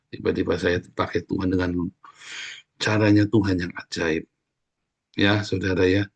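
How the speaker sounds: ordinary delivery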